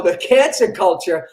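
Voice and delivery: ordinary talk, nothing marked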